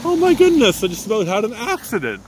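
real shock after nearly crashing; startled gasp. Real, not acted. Nearly had an accident.

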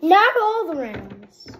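loud, high protest. Real, not acted. Protesting the game result.

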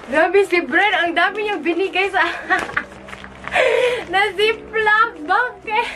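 high giggling disbelief; laughing excitedly. Real, not acted. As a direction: squeal and giggle through it. Laughing at generous freebies.

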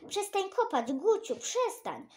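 high urgent shout, slowing at the end. Acted. Children warning Gucio about the wolf, high urgent shout. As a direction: yell at him to stop digging. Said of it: "krzyczeliśmy".

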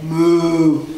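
low, drawn-out moo; cow moo. A narrator's reading. Cow talking to Little Blue, low moo. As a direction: hold a long moo like a cow. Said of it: "Cow said".

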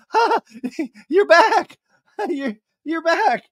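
high, strained laughing reply; nervous fake laugh. Assistant Ann greeting Sandoval, high nervous laughing voice. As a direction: force a tense laugh. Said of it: "she's like".

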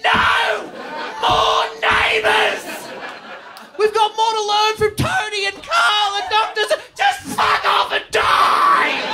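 hammed-up corporate rant; mock furious demand. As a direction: yell it like a greedy executive. Amazon voiced demanding more Neighbours.

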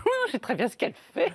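quick, sly knowing voice; knowing spectator. Retold or imitated. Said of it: "ils se disent". (spectateur complice, voix rapide et entendue)

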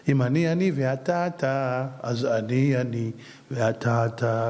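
very slow, low, deliberate; patient unhurried teaching; slow explanation. Shown as a demonstration. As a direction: spell out each word so it lands. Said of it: "תראה, אם אני אתה".

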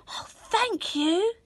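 put-on girl thanking the woman for the pot; grateful girl. A narrator's reading. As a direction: thank her sincerely.

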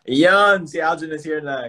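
high, loud ayun; sudden delighted spotting; excited. Real, not acted. Host sees guest joining the live, excited.

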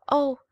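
sudden high loud cry. A narrator's reading. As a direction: cry out in longing. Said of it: "strange loud cry".

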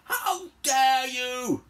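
slow, high, loud bellow; outraged roar. Acted. Cleopatra refusing to lend her staff, theatrical roar. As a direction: stretch each word in fury. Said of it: "roared Cleopatra".

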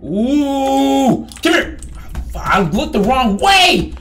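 high, loud gamer yelling; loud, high-pitched outburst; frantic shout. Real, not acted. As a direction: shout in a panic mid-chase. Frantic chase in the game.

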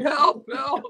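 high, loud mock cries for help; imitated yelling. Retelling a drunk friend stuck under a concrete barrier, mock yelling. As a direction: yell it out like someone trapped, played for laughs. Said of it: "He's yelling".

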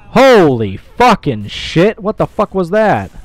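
loud, high, stretched-out exclamation; shocked outburst. Real, not acted. Player startled by a distant attack, shocked shouting. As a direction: shout it out, stunned by what just happened. Startled by sudden game event.

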